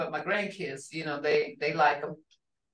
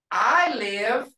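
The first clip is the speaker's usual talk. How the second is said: ordinary talk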